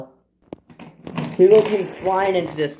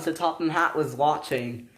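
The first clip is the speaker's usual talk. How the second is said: usual speaking voice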